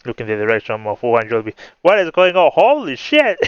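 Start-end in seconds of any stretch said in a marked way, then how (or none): 1.8-3.4 high, loud, startled shout; alarmed outcry. A narrator's reading. Character reacting to people falling from above, alarmed shout. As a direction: shout it in shock, pitch leaping up.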